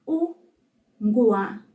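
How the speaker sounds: slow, broken into separate syllables; syllable-by-syllable demonstration. Shown as a demonstration. Teacher models the word for consonant, syllable by syllable. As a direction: pause between u, ngwa and qa. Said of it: "Repeat that after me".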